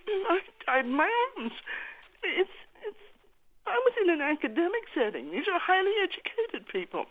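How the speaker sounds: high, halting voice full of real grief; pained. Real, not acted. Mother recalls her son dying in her arms, pained. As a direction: push the words out through the pain. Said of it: "hear the pain in your voice".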